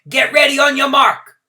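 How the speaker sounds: loud, high starter's call. A narrator's reading. Starter Bump Gilliam calling the runners to their marks, loud call. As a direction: call the compromise out to everyone. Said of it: "Bump Gilliam called out".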